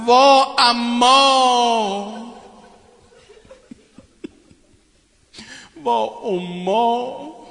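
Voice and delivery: very slow, stretched wail; long grieving call that fades away; mournful cry. Retold or imitated. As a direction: draw out the lament, fading. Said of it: "فریاد زد".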